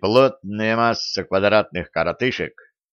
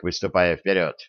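plain reading voice

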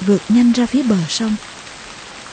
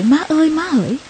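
put-on baby crying for mom; 7 semitones higher than usual. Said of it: "gọi".

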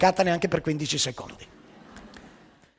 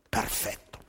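awed hush; soft unvoiced whisper of wonder; 7 semitones lower, 10 dB quieter, slower than usual. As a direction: breathe it out, amazed. Narrator marvels at drinker.

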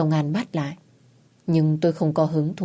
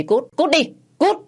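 high, explosive order to leave; angry high-pitched yell; furious shout; 10 semitones higher, 3 dB louder than usual. A narrator's reading. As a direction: shout them out of the house. Husband throws wife out, again.

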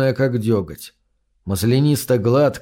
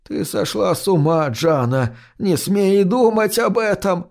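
high, tearful grandmother plea; a touch of tears, rising higher; crying grandmother; 6 semitones higher than usual. Acted. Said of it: "плачет она".

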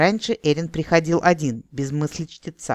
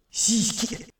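high, unvoiced whispery outburst; choked-off thought; 7 semitones higher than usual. A narrator's reading. Investigator's stray thought slips out, choked and whispered. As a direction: hiss it out as it escapes you. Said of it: "подавился мыслью Эрин".

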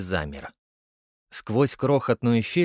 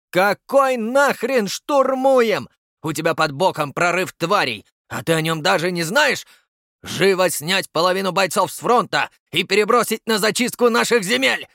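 high, loud raging commands; furious yelling; 8 semitones higher, 5 dB louder than usual. Acted. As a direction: bark orders in fury. Граф орёт на генерала. (орёт на генерала)

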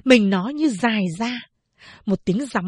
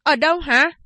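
higher, louder urgent question; asking loudly; 6 semitones higher, 4 dB louder than usual. Acted. Teacher bursting into the dark classroom, asking loudly. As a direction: call out anxiously into the dark room. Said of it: "hỏi to".

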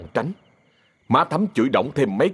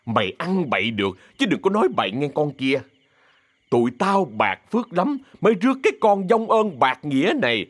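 angry cursing; 6 semitones higher than usual. Acted. Má Bảy curses Thắm and her mother, angry cursing. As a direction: curse them out, voice climbing.

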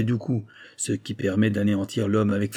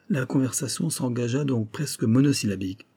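plain reading voice

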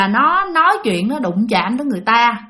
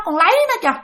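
put-on drunken boast; 8 semitones higher than usual. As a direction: brag loosely like a tipsy man. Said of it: "Cậu nói vậy đó".

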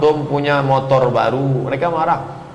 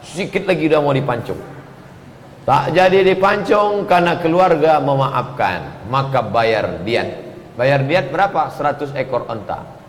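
ordinary talk, nothing marked

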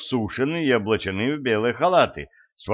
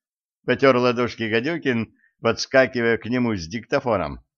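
ordinary delivery

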